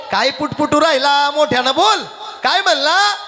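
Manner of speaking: high, bossy challenge; scolding demand. Acted. Jijabai मोठ्यानं बोलायला दटावते. (दरडावून, चढ्या आवाजात)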